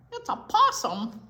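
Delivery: high, loud delighted answer; excited reveal. Real, not acted. Teacher excitedly names the possum for the kids. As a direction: announce it with glee. Naming animal in picture.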